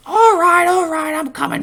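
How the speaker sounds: very high, piercing Cockney-tinged squeal, like Pinky; Skull's squeaky voice. Retold or imitated. Fan imitating Skull giving in to Bulk, piercing high squeal. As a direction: pitch way up into a shrill cartoon squeak. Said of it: "this piercing, high-pitched voice".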